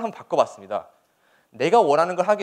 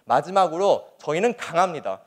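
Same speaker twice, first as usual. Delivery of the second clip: normal talking voice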